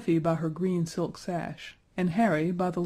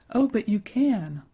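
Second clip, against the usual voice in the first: crying out; 4 semitones higher, 4 dB louder than usual. A narrator's reading. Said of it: "cried Effie".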